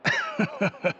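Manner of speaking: short, mocking little laugh; amused laugh. Real, not acted. Venger laughing off Chiori's blunt demand, short amused laugh.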